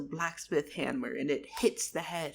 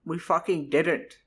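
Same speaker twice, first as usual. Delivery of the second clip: louder, firm correction; blunt retort; 6 dB louder than usual. Acted. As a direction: cut him off, loud and blunt. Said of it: "Charles was like".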